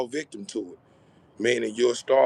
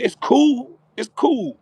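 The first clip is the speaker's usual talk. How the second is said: excited; 12 semitones higher, 6 dB louder, slower than usual. Real, not acted. Sudden burst of reassurance.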